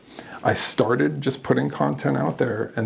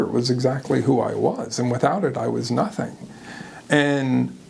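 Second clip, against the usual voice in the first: ordinary delivery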